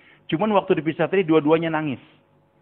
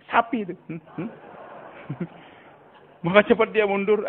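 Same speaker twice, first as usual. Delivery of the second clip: normal talking voice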